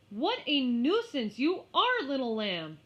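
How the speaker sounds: annoyed scolding. Acted. Mary scolding the lamb.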